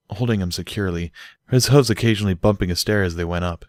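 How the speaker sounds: ordinary delivery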